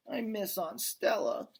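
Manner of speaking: put-on high small child's voice, mild sob; a little elephant missing her aunt, lightly tearful; sobbing child. A narrator's reading. Young elephant tells Ivan she misses Stella, high and teary. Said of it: "Ruby sobbed".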